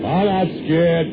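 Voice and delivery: remembered defiance. Retold or imitated. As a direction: say it slow and cocky. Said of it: "you hear yourself say".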